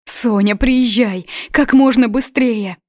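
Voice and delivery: high, loud, desperate urging; urgent. Acted. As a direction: urge her to hurry, frantic. Mother urging daughter to come.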